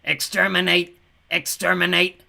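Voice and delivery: high, loud monotone war cry; robotic yelling. A narrator's reading. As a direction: yell it like a menacing machine.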